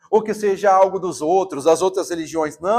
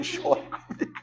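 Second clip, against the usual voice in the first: laughing, higher and soft; laughing quote; 7 semitones higher, 8 dB quieter than usual. Retold or imitated. Preacher voicing God's invitation, laughing softly. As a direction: say it through a tender laugh. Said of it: "mas ele vai dizer".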